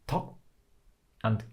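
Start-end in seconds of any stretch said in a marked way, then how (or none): 0.1-0.4 "to" cut off on a closed k, then silence; tiny break. Shown as a demonstration. Teacher showing the break in takke, cut-off "to". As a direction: stop dead at the end of "to". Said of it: "this tiny little break between".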